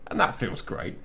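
ordinary talk, nothing marked

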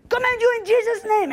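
high-pitched, straining order; frantic command. Retold or imitated. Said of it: "My voice started to go higher and higher".

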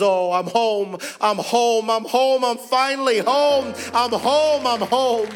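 climbing, emotional repetition of home; overjoyed cry. Retold or imitated. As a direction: voice a refugee's tearful relief at finally belonging. Weeping convert exclaiming in the van.

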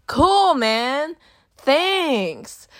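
high, drawn-out mock gratitude; sarcastic thanks. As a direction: act out insincere approval, stretching each word. Sarcastic reply to date's rude remark.

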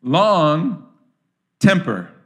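Long and temper set apart with pauses; spelled-out meaning. Shown as a demonstration. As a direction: spell out the two parts one by one. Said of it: "thumos means temper".

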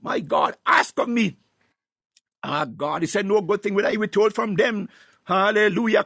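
ordinary delivery

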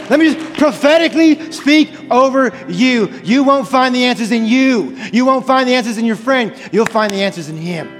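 ordinary talk

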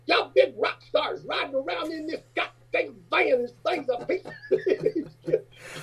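high, slow, soft razzing with a laugh; mocking little guy. To the band in their old van, high slow teasing. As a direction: needle the band slowly in a high voice.

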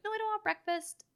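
put-on whiny kid. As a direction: play the stubborn child. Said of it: "the kid is like".

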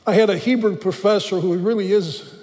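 ordinary talk

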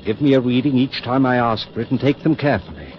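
high, loud, tense instructions; keyed-up orders. Acted. Surgeon giving tense orders before the readings, loud and high. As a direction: drive the assistant with urgent energy.

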